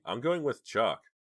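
high, loud and slow; determined. Acted. Scared girl insists on leaving.

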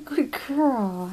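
ordinary delivery

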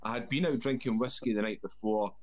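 ordinary delivery

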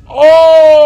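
high, loud gasp then yelling insult; panicked shriek. Real, not acted. Streamer spotted by the monster, screams. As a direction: scream and scold yourself. Monster appears, yells at self.

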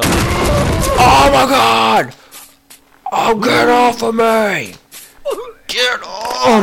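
very high, loud drawn-out scream; panicked scream. Real, not acted. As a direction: yell in panic to shake it off. Attacked in game, screams.

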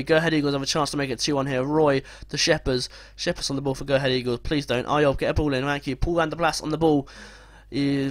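normal talking voice

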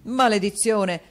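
exclaimed curse. A narrator's reading. Husband hearing his allowance, cursing loudly. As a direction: curse the sum aloud. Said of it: "esclamò il signor Mantalini".

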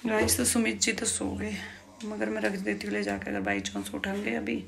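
usual speaking voice

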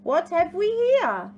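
high, loud, fluttery question; theatrical curiosity, pitched high; fluttering. Acted. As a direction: swoop through it like a grand, fluttery emu. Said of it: "she fluttered".

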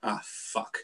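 unvoiced, hushed swear under the breath; muttered curse. Kane backstage, seeing everyone, swearing under his breath. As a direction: whisper it in dismay. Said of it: "turned up going".

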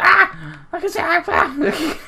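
sudden high yelps of fright; startled yelp. Real, not acted. Gamer startled by something on screen, high yelps. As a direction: jump out of your skin, squeaking.

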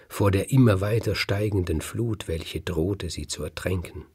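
plain reading voice

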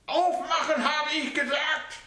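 put-on old mother voice barking an order; demanding old mother. A narrator's reading. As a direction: order him to open up.

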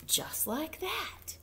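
slow hushed whisper. A narrator's reading. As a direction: drop to a secret whisper. Storyteller's dramatic hush.

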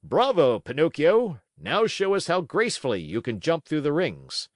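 loud, high showman's praise; ringmaster's cry. A narrator's reading. Manager cracking his whip and praising Pinocchio, loud high showman cry. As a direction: call it out grandly for the crowd. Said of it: "cried out".

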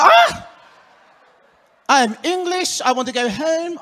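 high, panicked English yelp; hammed-up fright of a tourist who meets a wild dog; mock terrified cry. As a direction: squeal like you just met a wolf.

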